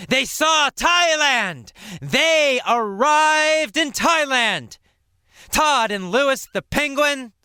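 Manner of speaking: high, bright triumphant cry; joyful excitement. Shown as a demonstration. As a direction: celebrate the arrival. Joyful arrival reveal.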